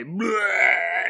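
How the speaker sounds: mock vomiting sound; hammed-up retch; retching noise. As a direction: gag like throwing up. Cop vomiting.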